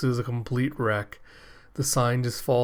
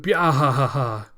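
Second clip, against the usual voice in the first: villain laugh; 5 dB louder than usual. Acted. Makaku laughing.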